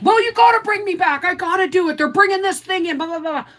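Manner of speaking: put-on high, loud bratty teenager demanding; mimicking whiny son. Son in the parking lot demanding mom bring him back, high whine. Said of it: "And he's like".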